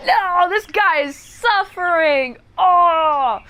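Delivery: high, hammed-up wail of dismay; mock agony on his behalf; anguished sympathy. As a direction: cry out for the poor trapped guy, ending in a loud groan. Reacts to the man's plight.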